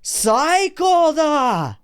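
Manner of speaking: long, slow drawn-out shout; battle cry. Acted. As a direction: scream the attack name, stretching it. Fight climax battle cry.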